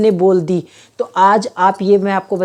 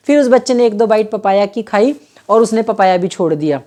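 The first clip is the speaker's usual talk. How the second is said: normal talking voice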